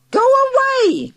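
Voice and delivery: very high, loud, slow shout. Acted. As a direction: drag it out like a furious aunt. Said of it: "Aunt Doris shouted".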